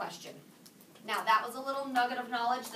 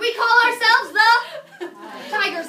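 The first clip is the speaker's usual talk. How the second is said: hammed-up team cheer; 7 semitones higher, 11 dB louder than usual. Teacher translating the team's announcement, hammed-up cheer. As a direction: belt it out like a proud team.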